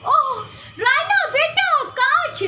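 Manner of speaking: mother shouting to daughter amid gunfire; worried call. Acted. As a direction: call across the room to the daughter. Mother calling during gunfire.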